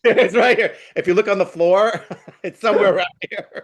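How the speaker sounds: can't stop laughing while telling it. Real, not acted. Storyteller retelling the wife's answer, laughing.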